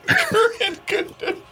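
high laugh, then the phrase said slow through it. Real, not acted. Man laughing at his own weather-report phrase about the dog. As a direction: chuckle out the words.

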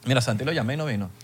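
ordinary talk, nothing marked

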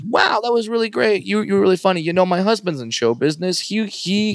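high, bright mock woman's voice; imitating the woman. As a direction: play the sweet fan gushing up high. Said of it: "comes up to me after the show and says".

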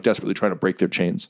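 ordinary talk

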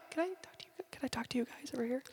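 hushed secretive whisper. Acted. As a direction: pull them aside and whisper. Secretive aside.

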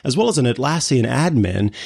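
ordinary talk, nothing marked

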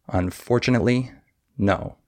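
"Unfortunately" with beat on FOR, "tunately" fast and short; fast unstressed syllables. Shown as a demonstration. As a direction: squeeze "tunately" between FOR and "no". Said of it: "how fast and how short".

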